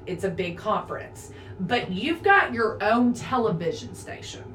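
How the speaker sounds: ordinary talk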